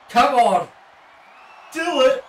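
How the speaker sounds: loud, high urging shout; excited cheering. Real, not acted. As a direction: yell at the screen to do it. Cheering on wrestler in game.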